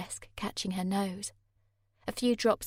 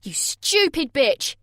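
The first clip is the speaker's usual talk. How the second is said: loud, slow, higher yelled insult; 7 semitones higher, 12 dB louder, slower than usual. A narrator's reading. Jay yelling at Sammy as he kicks her. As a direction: spit the insult out in a yell. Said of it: "he yelled".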